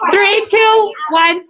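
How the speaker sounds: high, loud countdown to regain attention; countdown call to the class. Real, not acted. Teacher counting down the class after partner talk, high and loud. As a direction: call the room back to order.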